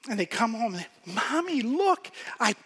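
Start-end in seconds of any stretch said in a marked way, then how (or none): 1.1-2.5 put-on higher, eager child voice; little child voice. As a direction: play an excited little kid. Child shows drawing to mother.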